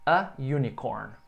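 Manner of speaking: "A unicorn" said slowly with "A" stressed; teacher modelling English; slow model phrase. Shown as a demonstration.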